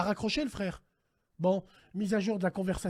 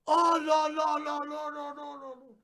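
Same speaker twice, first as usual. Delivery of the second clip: mock despair; 4 semitones higher than usual. Despair after deepfake fantasy.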